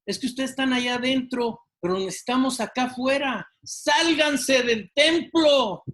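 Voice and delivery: high, loud, insistent shouting; the protester demands they come out; mock protester shouting at the pastor. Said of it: "una de las gentes de la manifestación le dice".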